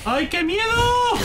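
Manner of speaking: high, slow squeal of fake fear; mock fright. Gamer squeals at horror game scare in mock fear. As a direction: squeal like a scared kid, hamming it up.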